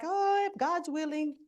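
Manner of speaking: hint of an Italian mother's accent; a light Italian lilt on the reply; mild Italian accent, imitating mom. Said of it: "she's Italian".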